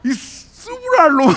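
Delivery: high, slow Jeolla-style swearing; delighted mock cursing; cursing Jeolla friend. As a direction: drag out the curse like a rough old buddy. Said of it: "쌍욕이 막".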